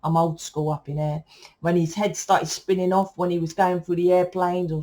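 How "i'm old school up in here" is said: ordinary talk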